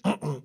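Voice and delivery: short closed-mouth "mm-mm"; closed-mouth refusal. Acted. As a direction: refuse with lips shut, cheeks full. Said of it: "with his big cheeks".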